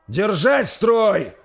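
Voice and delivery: very slow, loud, high command. Acted. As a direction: hold them with a long bellowed order. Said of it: "скомандовал Горд".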